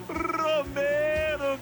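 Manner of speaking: explosive goal commentary. Acted. Goal narrator drawing out the name.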